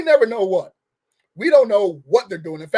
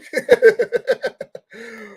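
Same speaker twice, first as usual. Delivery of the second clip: amused laugh; 3 semitones higher, 4 dB louder than usual. Real, not acted. Laughs at her own sarcasm.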